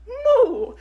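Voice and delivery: high, laughing moo; Italian cow moo. Retold or imitated. Imitating an Italian cow's moo. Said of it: "Italian cows be like".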